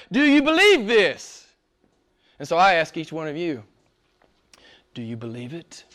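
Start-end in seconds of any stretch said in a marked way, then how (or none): 0.1-1.5 high, ringing question from scripture; proclaimed scripture question. A narrator's reading. Reads Jesus' question aloud, projecting. 4.9-5.8 low, very soft question; quiet, low, searching question; hushed personal question. Real, not acted. Puts question personally to congregation.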